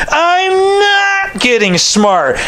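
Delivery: very high, slow, whining protest; whiny teen. Retold or imitated. Teenager whining back at his father, mock whine. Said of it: "And they would say".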